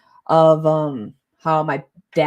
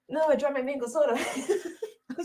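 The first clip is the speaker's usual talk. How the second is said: laughing; 9 semitones higher, 6 dB quieter, faster than usual. Real, not acted. Drops soda, laughs while talking.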